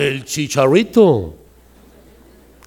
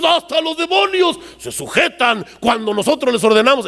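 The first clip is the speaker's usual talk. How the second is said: much higher, shouted, bragging; boasting disciples; 6 semitones higher than usual. Acted. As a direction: crow about the demons obeying. Discípulos presumiendo sus exorcismos. (discípulos presumiendo a gritos, voz mucho más aguda)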